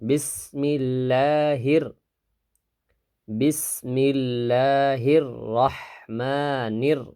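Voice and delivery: drawn-out chanted Bismillah; slow recitation. Shown as a demonstration. Teacher leading students to repeat slowly. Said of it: "আমার সাথে সাথে উচ্চারণ করবেন".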